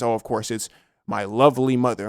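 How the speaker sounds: usual speaking voice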